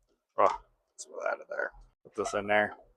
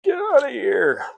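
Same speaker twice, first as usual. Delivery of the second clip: playful shout; 9 dB louder than usual. Real, not acted. Yelling at food playfully.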